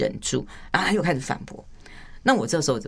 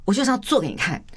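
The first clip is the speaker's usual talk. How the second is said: much higher, insistent declaration; mock-determined outburst; acted defiant vow; 10 semitones higher than usual. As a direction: declare it as if proving doubters wrong. Acted vow to prove oneself.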